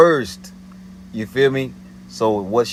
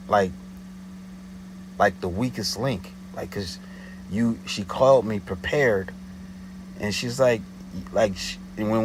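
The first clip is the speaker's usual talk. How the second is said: usual speaking voice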